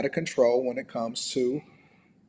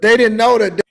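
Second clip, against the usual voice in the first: rising shout; 6 semitones higher, 8 dB louder than usual. Real, not acted. Rant building.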